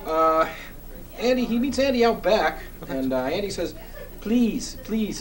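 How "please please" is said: pleading in a mock foreign accent, a little higher; Andy's foreign accent. Andy begging Bob for help, mock foreign accent. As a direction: beg in a light put-on foreign accent. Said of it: "in that accent of his".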